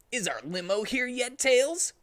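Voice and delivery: high, rising impatience; can't wait for the limo; eager Sonic. Acted. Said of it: "said Sonic".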